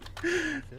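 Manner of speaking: soft high breathy giggle; real amused giggle. Real, not acted. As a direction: giggle in disbelief. GM laughs at player's answer.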